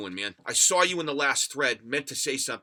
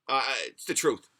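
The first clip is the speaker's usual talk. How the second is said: ordinary talk, nothing marked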